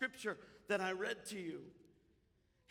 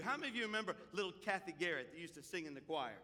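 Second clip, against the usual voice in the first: ordinary talk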